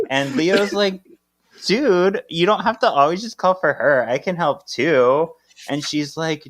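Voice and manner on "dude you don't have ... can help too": higher-pitched acted protest; Leo protesting. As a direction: play an exasperated helper, voice lifted. Voices Leo arguing with Piper.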